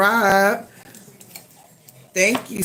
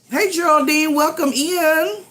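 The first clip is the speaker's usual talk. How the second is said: sing-song greeting; 10 semitones higher than usual. Real, not acted. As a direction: call out a cheerful welcome. Greeting viewer in live chat.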